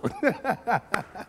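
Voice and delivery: short high chuckle; amused little laugh. Real, not acted. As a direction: laugh at his own confusion. Laughs at college name mixup.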